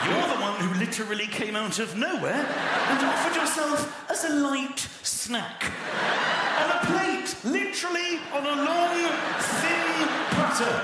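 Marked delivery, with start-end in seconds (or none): 2.0-10.8 high, loud shouted scolding; exasperated rant. Real, not acted. Comedian rants at the shark victim, shouting high and loud. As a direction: yell it in comic exasperation.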